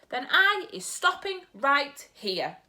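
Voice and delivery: offended giant, sharp and slow. Acted. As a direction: cut it off sharply. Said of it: "said the B F G sharply".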